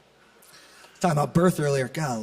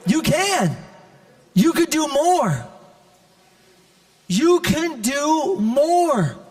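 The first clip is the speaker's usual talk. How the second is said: impassioned preaching; 7 semitones higher than usual. Real, not acted. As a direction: preach it up high, hammering each repeated phrase. Preaches encouragement at full force.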